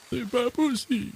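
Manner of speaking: put-on very high, thin old-man voice; high-pitched doddering old codger; Grampa Simpson. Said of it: "en s'inspirant de la voix de grand-père Simpson".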